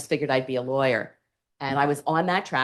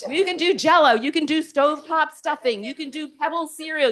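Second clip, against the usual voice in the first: much higher, bubbly salesy list; eager HR pitch; 11 semitones higher than usual. Retold or imitated. HR rep pitching products to the new intern, bubbly and much higher. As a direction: sell every brand with gusto. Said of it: "H R says to me".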